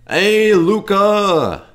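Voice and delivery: loud, high drawn-out holler; calling out. Acted. Boss calls out to Luka in the locker room, loud drawn-out call. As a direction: holler the name, stretching it out high.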